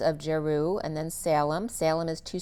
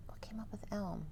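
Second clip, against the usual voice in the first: breathy whispered aside to herself; 2 semitones higher, 16 dB quieter, faster than usual. Real, not acted. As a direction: whisper the question under your breath.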